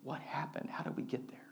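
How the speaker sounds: soft, near-whispered questions; hushed questioning. Real, not acted. Pastor asks congregation how the crowd got there, hushed. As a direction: drop to a hush to draw listeners in.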